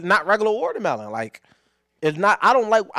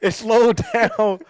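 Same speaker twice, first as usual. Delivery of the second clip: very drawn-out, raised dramatic delivery; slow-motion storytelling; 4 semitones higher, 4 dB louder, much slower than usual. Retold or imitated. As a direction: stretch every word like a slow-motion replay.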